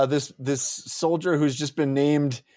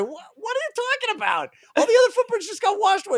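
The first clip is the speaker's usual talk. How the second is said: very high, rushed squeaky objection; baffled protest; much faster than usual. Retold or imitated. Big guy objecting to the footprint theory, high baffled protest. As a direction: imitate a baffled guy, pitched way up and fast.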